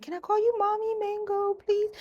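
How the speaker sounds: high, sweet begging voice; a high pleading ask; pleading fan. Retold or imitated. A fan begging for a nickname, high and sweet. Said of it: "somebody approach me like".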